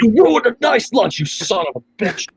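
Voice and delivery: high mock-angry movie line, dropping at the end; hammed-up gangster cursing; quoted gangster outburst. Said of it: "the best line in the movie".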